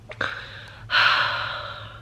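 long, breathy sigh after crying; heavy sigh. Real, not acted. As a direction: let out a long exhale to recover. Composing herself after crying.

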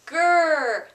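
short loud playful growl; quick growl. Pretend. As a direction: snap out a little bear growl.

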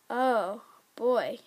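ordinary delivery

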